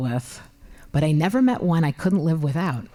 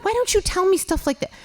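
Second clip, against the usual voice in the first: exasperated complaint; 9 semitones higher than usual. Retold or imitated. Wife complaining to husband.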